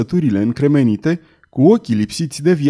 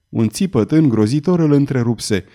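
ordinary delivery, nothing marked

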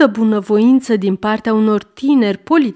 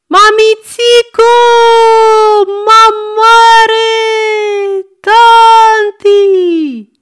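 put-on a terrified boy wailing, much higher and louder, slow; bawling child; 13 semitones higher, 9 dB louder, much slower than usual. A narrator's reading. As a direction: scream for mama and grandma in a panic. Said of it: "începe să zbiere". (băiat care zbiară îngrozit după mamă și bunică, mult mai sus și mai tare)

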